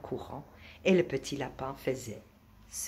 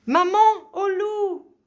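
high, slow, full-force yell for help; hurlement d'appel; 14 dB louder, slower than usual. A narrator's reading. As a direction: scream for mum, drawing each word out. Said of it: "hurla le petit lapin". (hurlement aigu pour appeler maman)

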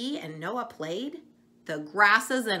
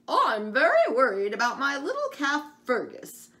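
a worried mother cow, higher and louder; mooing cow; 7 semitones higher, 6 dB louder than usual. Acted. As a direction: moo the words out like an anxious cow. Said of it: "mooed Fergie the cow".

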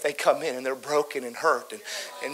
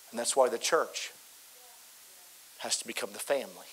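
soft tender; 4 semitones lower, 7 dB quieter than usual. Acted. Quiet tender reflection.

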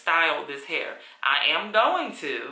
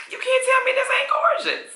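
much higher, squealing with delight; excited squeal; 4 dB louder than usual. Real, not acted. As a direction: gush over the hair in a high squeal. Gushing over her wig.

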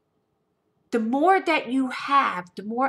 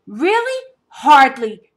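scornful scoff; 3 semitones higher, 9 dB louder, slower than usual. Retold or imitated. Mocking the ex's attempt to win her back, contemptuous. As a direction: dismiss him with contempt.

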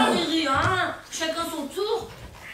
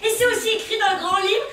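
loud, raised, declaiming retort; defiant outburst; 5 semitones higher, 6 dB louder than usual. Acted. Witness parodying the inspector's solemn speech, loud defiant outburst. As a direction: throw it back loudly and grandly.

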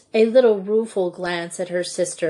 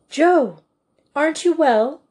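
higher and louder, sudden concern; alarmed call; 6 semitones higher, 4 dB louder than usual. A narrator's reading. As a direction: call out to her, startled and worried. Teacher alarmed at sick pupil.

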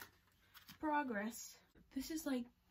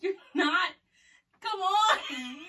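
laughing; 8 semitones higher, 10 dB louder, slower than usual. Real, not acted. Laughing protest at mom.

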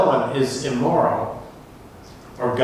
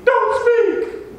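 terrified plea; 6 dB louder than usual. Acted. Israelites beg God to stop.

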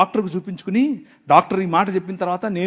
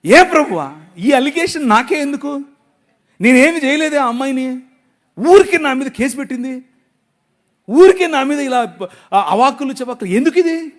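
indignant complaint; 5 semitones higher, 5 dB louder than usual. Retold or imitated. Quoted complaint to God.

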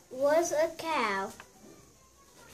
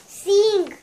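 loud, higher giant order; giant command; 4 semitones higher, 8 dB louder than usual. Acted. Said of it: "said the giant".

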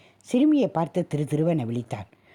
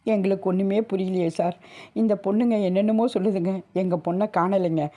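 tearful plea; 4 semitones higher than usual. Acted. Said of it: "அழுது கொண்டே".

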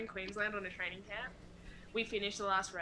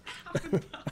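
laughing, higher and louder; amused echo; 9 semitones higher, 5 dB louder than usual. Real, not acted. Athlete echoes interviewer's question, laughing. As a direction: repeat it through real laughter.

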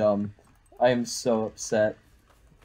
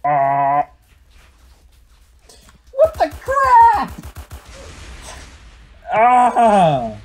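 shrill slow scream, much higher and louder; 10 semitones higher, 8 dB louder, much slower than usual. Real, not acted. Gamer startled by an explosion, screaming high and loud. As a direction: scream in sudden fright. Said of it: "Can you stop screaming?".

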